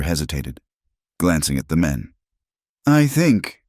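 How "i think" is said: put-on drunk man. A narrator's reading. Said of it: "the drunk man said".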